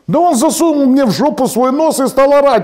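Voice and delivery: high, loud indignant bride; acted indignant outburst; outraged complaint. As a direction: complain at a high, heated pitch.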